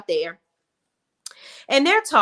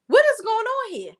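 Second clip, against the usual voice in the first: high, put-on shocked voice; mock alarm; 13 semitones higher than usual. Real, not acted. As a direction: feign shock at the scene.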